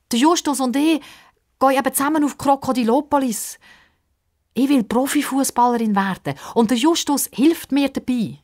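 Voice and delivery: put-on high eager girl; Pippa's voice. A narrator's reading. Pippa explains her plan to old man, high eager girl. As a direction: explain proudly.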